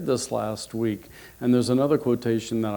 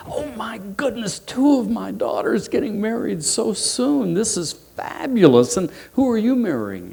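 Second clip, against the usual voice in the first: excited mother; 10 semitones higher than usual. Acted. Potato mother hearing a second daughter will marry, excitedly gushing. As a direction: squeal with delight like a thrilled mom. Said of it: "the mother got excited as well".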